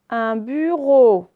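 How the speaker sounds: "Un bureau" slow and loud, "reau" held; syllable by syllable. Shown as a demonstration. Teacher showing O at the end, syllable by syllable. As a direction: stretch the final O of "bureau". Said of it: "à la fin".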